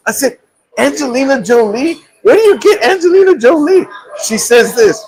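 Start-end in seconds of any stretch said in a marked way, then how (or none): 0.8-3.9 higher, exasperated demand; mock upset. Husband in the car asking his wife, exasperated. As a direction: complain to her in disbelief. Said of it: "I'm upset".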